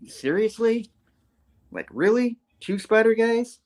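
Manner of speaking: high, rising mock disbelief; incredulous. Slide facing two Spider-Men, acted incredulous. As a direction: question it in a high exasperated voice.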